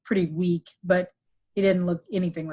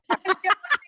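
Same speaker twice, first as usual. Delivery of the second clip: high burst of laughter; high giggle; 12 semitones higher than usual. Real, not acted. Laughing at the memory.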